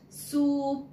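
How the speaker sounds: teacher drawing out the oo in Soup; long stressed oo. Shown as a demonstration. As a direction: lean hard on the oo of Soup. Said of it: "we spoke the oo sound with lot of stress".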